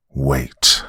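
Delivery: low, forceful "Wait"; angry snarl. A narrator's reading. Man cutting off the father, angry snarl. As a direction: snarl "Wait" as a stern command. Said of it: "I snarled in anger".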